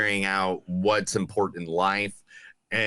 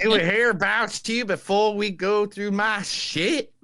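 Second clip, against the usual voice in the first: put-on Southern accent; 9 semitones higher than usual. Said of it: "the Southern accent for every single quote".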